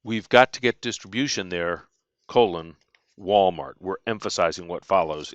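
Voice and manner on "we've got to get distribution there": ordinary talk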